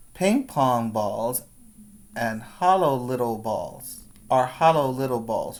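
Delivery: ordinary talk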